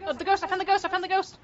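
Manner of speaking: excited shouting. Real, not acted. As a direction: call out the find eagerly. Finds the ghost, excited.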